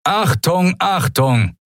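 high, urgent loudspeaker call; booming emergency announcer; thundering announcement. A narrator's reading. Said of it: "donnerte plötzlich die Stimme eines Menschen über versteckte Lautsprecher".

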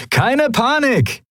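high, slow, drawn-out cheery call; cheerful call. A narrator's reading. Sephiron calls cheerfully to his pirate mates, high and slow. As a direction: sing it out, in the best of moods. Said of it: "rief Sephiron seinen Kumpanen in bester Laune zu".

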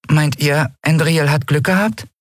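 high small creature voice; small winged creature, piping question; little Nelen. A narrator's reading. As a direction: ask it in a tiny high-pitched voice. Nelen's dialogue in narrated story.

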